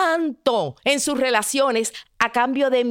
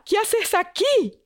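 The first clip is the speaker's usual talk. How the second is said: insistent father; 10 semitones higher than usual. Acted. Father repeats, more insistent.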